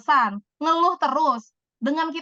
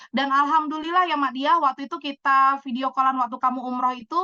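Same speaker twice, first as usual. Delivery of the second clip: ordinary talk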